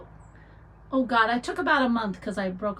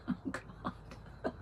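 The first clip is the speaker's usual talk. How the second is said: breathless whispered oh God; whispered embarrassed groan; whispered aside; 18 dB quieter than usual. Real, not acted. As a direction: mutter it under your breath, embarrassed. Reacting to birthday song request.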